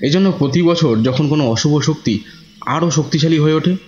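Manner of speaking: ordinary delivery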